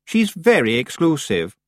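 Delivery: high, slow, snobbish boast; proud. A narrator's reading. Anagramma boasting about Missus Earwig, proud. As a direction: say it slowly and loftily. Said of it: "Anagramma added proudly".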